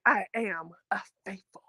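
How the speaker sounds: hushed, breathy whisper of a confession; a soft whispered aside; whispered quote. Retold or imitated. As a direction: whisper it like a secret admission. Retelling housemate's confession.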